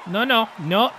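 ordinary delivery

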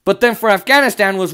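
ordinary talk

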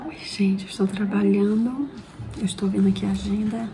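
breathy whisper at work. Real, not acted. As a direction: whisper so nobody overhears. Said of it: "Tô falando baixo porque tem cliente".

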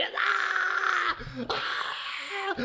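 high mock screams; cartoon screaming in long bursts; horrified screams. Dirty Birdie looking at the hideous creature, high mock screams.

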